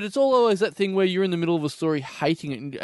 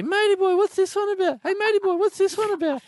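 high nasal-free squeaky pester, much higher than his own voice; pestering weirdo customer; 14 semitones higher than usual. Retold or imitated. Comic shop weirdo pointing at every comic, high pestering voice. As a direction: needle the shop guy in a high silly voice. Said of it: "Every day he would say".

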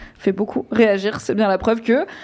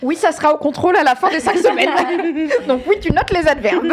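laughing; 7 semitones higher than usual. Real, not acted. Teasing about grading adverbs.

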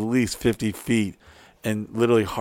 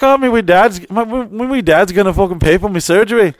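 high, loud mock Irish girl voice; Irish girl accent; 8 semitones higher, 6 dB louder than usual. [name] quoting his Irish girlfriend in a high Irish voice. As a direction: squeal it in her broad Irish lilt. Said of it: "She's like".

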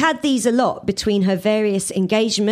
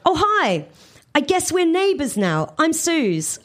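higher, cheery self as new neighbour; bright greeting; 7 semitones higher than usual. A narrator's reading. Suze greets her new neighbour Ian, high and cheery. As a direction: chirp a friendly hello. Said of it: "I said".

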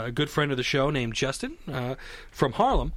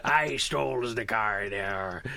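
slow, lower pirate taunt; mock pirate; 5 semitones lower, slower than usual. S0 mocks S1's accents, which turn into a pirate taunt. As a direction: growl it out like a swaggering pirate. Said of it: "you would go into pirate".

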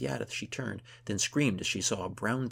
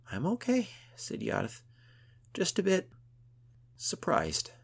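shaken; 11 semitones higher, 5 dB louder than usual. Acted. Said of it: "she was shaking".

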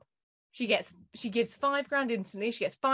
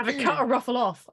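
ordinary delivery

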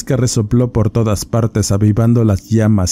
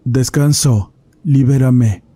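higher, eerie otherworldly voice; a dead spirit speaking through a goat; voice from beyond the grave; 4 semitones higher, slower than usual. A narrator's reading. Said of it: "una voz de ultratumba".

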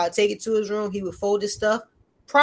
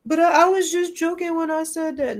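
put-on higher whiny kid excuse; imitating her daughter, higher; mimicking daughter; 8 semitones higher, 4 dB louder than usual. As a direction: plead like a caught child.

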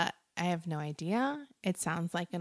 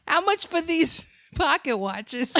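put-on Boston accent; 10 semitones higher, 8 dB louder than usual. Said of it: "they gave her a fucking Boston accent".

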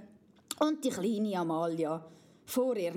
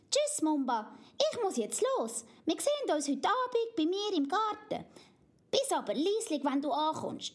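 put-on high, eager little girl voice; little girl Amalia; 6 semitones higher than usual. Amalia warns Mumba not to wake Papi, high child voice. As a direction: chatter like a bossy little princess.

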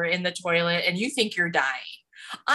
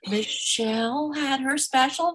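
prim grandmother; 7 semitones higher than usual. Retold or imitated. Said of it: "she calls my mom".

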